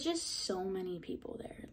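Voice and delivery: hushed, breathy whisper. Real, not acted. Whispering to the viewer about the crowd there, hushed. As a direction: drop to a whisper, awed. Telling of the intimidating audition.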